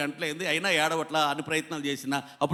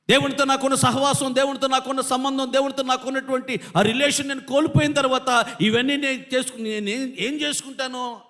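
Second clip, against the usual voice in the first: high, wailing plea in preaching voice; David's lament; 7 semitones higher than usual. Acted. Preacher voicing David's loss of God's fellowship, anguished lament. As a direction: pour out grief at a lost bond. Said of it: "దావీదు ఏడుపు కారణం అది".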